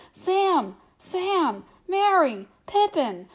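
high, loud frantic shouts; frantic shouting into the dark; shouting wildly. A narrator's reading. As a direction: yell the names in rising panic. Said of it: "shouting wildly".

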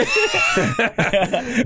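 high, shrieking burst of laughter. Real, not acted. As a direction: explode into a laugh. Bursts out at the joke.

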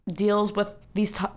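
usual speaking voice

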